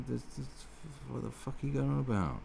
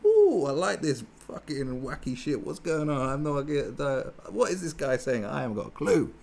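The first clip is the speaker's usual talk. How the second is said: happy crowd member; 5 semitones higher, 3 dB louder than usual. Retold or imitated. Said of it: "they're going".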